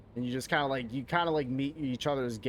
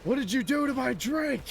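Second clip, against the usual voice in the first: high-pitched mock outrage; high, indignant accusation; outraged cartoon character; 11 semitones higher, 3 dB louder than usual. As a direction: imitate a cartoon fish demanding answers. Imitates outraged SpongeBob character.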